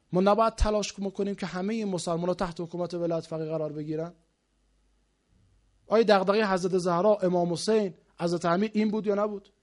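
normal talking voice

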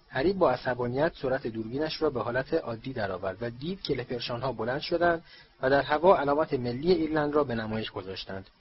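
usual speaking voice

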